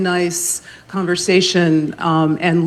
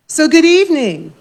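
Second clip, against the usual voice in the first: loud, higher call out to the whole room; call-out greeting; 9 semitones higher, 4 dB louder than usual. Pretend. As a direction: call it out so the audience answers back. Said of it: "call and response exercise".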